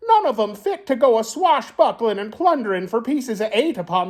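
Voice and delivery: high squawking parrot in pirate talk; the parrot answers the captain in pirate talk. Acted. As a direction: squawk out the pirate words proudly.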